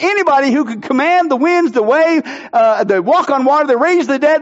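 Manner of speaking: ordinary delivery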